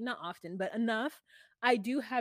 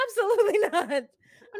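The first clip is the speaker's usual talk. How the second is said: higher, louder laughing absolutely not; laughing refusal; 11 semitones higher, 9 dB louder than usual. Real, not acted.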